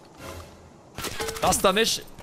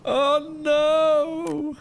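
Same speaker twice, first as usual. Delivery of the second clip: laughing dismay. Real, not acted. Laughing over the killcam.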